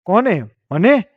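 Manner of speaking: alarmed one-word questions, pitch climbing; shocked questioning. Acted. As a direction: ask in startled, separate bursts. Told of poison, asks in shock.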